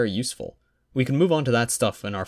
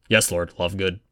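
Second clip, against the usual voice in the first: ordinary delivery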